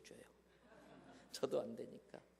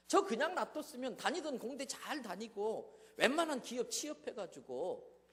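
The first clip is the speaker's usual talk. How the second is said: loud, higher, arguing with God; bitter complaint raised at heaven; defiant prayer; 6 semitones higher, 4 dB louder than usual. Retold or imitated. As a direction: talk back to God in frustration. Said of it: "하나님 앞에 막 대드는 기도".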